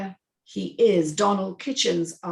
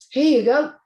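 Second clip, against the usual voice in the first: higher, louder smooth offer; Myron offering drink; 7 semitones higher, 8 dB louder than usual. Acted. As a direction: hand it over with a charming lift. Myron hands Tony a drink.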